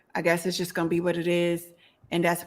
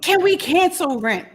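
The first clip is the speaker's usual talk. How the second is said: excited; 8 semitones higher, 5 dB louder than usual. Real, not acted. Loud high rant line.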